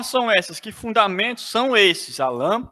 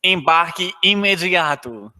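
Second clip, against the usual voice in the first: low, formal flight announcer voice; over the intercom; 5 semitones lower than usual. Pretend. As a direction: airport boarding call announcement. Mock airline boarding announcement.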